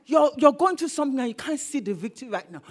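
normal talking voice

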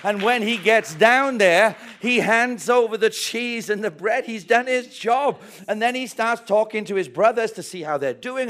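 loud, driving sermon delivery; preaching at full force. Acted. Preacher telling David's story to the congregation, booming. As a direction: preach it out to the room.

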